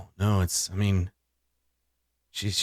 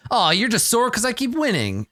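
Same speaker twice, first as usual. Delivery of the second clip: high mocking gambler voice; 11 semitones higher than usual. Half orc retorts.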